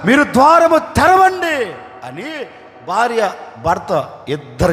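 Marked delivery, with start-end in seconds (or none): 0.1-1.7 raised, pleading preacher's prayer; fervent prayer. Acted. Couple crying out to God for blessing, pleading prayer. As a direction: beg God to open the door.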